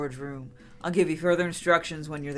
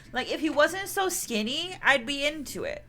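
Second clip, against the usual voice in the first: high gossipy aside; 6 semitones higher than usual. Acted. Aside in a character voice.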